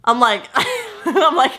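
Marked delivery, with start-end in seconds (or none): 0.5-1.0 a high, breathy giggle acted out; retold laugh. Retelling her reaction to the voodoo doll, high nervous giggle. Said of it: "I just start laughing".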